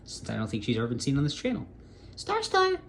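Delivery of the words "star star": very high, thin falsetto pet call; high-pitched kitty-calling voice; calling the cat. Real, not acted. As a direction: coo the cat's name way up high.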